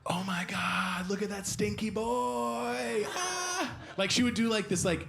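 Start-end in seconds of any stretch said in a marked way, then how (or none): 0.1-3.1 high, drawn-out mock squeal; imitated girlish squeal. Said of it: "polite, quiet scream that girls do". 3.1-3.7 short high imitation scream; a girlish little mock scream; polite quiet scream. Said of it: "polite, quiet scream that girls do".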